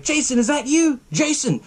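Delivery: high-pitched mock call for a friend. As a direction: call out as if searching for someone. Kid calling for his friend.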